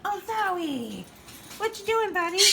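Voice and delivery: high delighted pet talk. Real, not acted. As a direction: cheer at a little bird. Cooing at pet bird.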